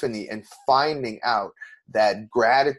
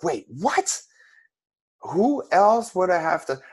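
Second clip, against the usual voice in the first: higher, jolted wait what; startled realisation; 8 semitones higher, slower than usual. Retold or imitated. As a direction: snap awake at the question. Sudden realisation at Robbins' words.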